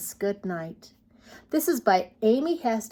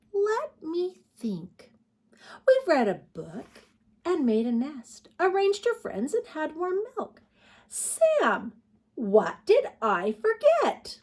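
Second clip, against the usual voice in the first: mother bear; 9 semitones higher than usual. Acted.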